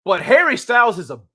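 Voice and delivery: hammed-up British accent, punchy and indignant; mock British influencer. Streamer imitating the influencer throwing [name] under the bus, mock British. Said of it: "He's like".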